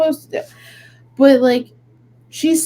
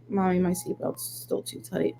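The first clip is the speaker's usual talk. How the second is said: put-on much softer small kid voice; toddler voice; 4 semitones lower, 12 dB quieter than usual. Mom imitating her daughter whispering from the back seat. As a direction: say it tiny and innocent. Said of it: "she turns around and she goes".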